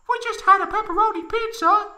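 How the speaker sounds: put-on high falsetto girl voice; high Tifa voice.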